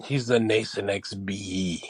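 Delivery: low, drawn-out mock Spanish accent; name it slowly in a deep Spanish accent; Nasonex bee. Explaining the cartoon bee, low Spanish accent. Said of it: "getting out of Spanish now".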